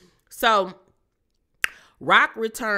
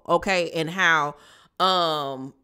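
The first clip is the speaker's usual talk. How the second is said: ordinary talk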